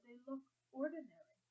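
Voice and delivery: a hint of a young girl's voice; light girlish tone; lightly voiced girl. A narrator's reading. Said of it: "she said".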